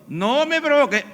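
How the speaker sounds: high-pitched scolding warning; acted parental threat; exasperated parent. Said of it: "Cuando tus hijos se están portando mal".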